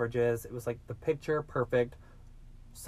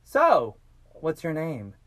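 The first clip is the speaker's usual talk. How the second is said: breezy opener, pitched up and louder; mock small talk; 4 semitones higher, 8 dB louder, slower than usual. Retold kidnapper making small talk with his captive, breezy. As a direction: hit the first word big, like casual chitchat. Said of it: "trying to make all this small talk".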